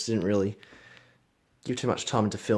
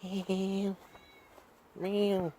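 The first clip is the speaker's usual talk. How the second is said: quiet imitated cat meow, higher pitched; gentle mock meow at a pet; soft meow; 7 semitones higher, 9 dB quieter than usual. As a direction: meow softly to coax the cat. Calling to the cat.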